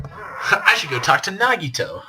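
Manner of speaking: slow, high, laughing goofy voice. Acted. Player mocking the plan to visit Nagito, laughing. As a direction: chuckle through it slowly.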